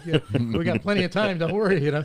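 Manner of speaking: mock reassurance, laughing through it. Retelling Vinny's carefree reassurance.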